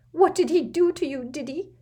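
quavering voice. Acted. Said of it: "in a quavering voice".

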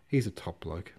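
plain reading voice

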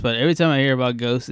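ordinary delivery

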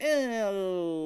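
high, drawn-out mock "oh"; mock shocked reaction. Exaggerated reaction to imagined murder news.

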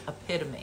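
"epitome" with stress on PIT, ending mee; correct pronunciation. Retold or imitated. Daughter recognizing the spelled word, "epitome" as eh-PIT-uh-mee. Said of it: "It is epitome".

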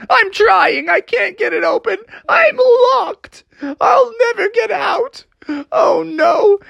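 put-on very high, wailing kid voice; tearful panic. Froggy answers the bystanders through the door, wailing. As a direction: wail hopelessly, hammed up. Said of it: "started to cry and panic".

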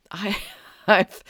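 breaks into a real laugh mid-word; real amused laugh cutting off the words. Real, not acted. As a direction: laugh at how absurd it sounds. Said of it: "I'm laughing because it's so absurd".

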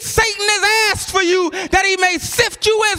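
high-pitched preacher's delivery building to a shout; impassioned sermon climax; full-force preaching. Real, not acted. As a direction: build the scripture into a ringing proclamation.

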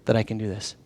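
very soft, low, earnest asking; hushed, direct appeal; face-to-face question. Acted. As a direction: drop the voice low and ask it gravely. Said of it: "he stares them face to face and says".

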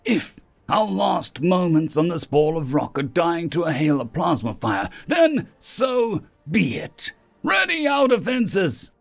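high, impassioned declaration building to a command; rally the room with fierce resolve; impassioned leader's high-pitched battle cry; defiant rallying speech. A narrator's reading.

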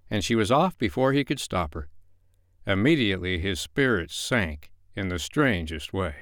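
usual speaking voice

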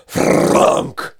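loud imitated clunk of heavy chain; noisy mouth-made crash; chain thud. Imitates chain hitting the ground.

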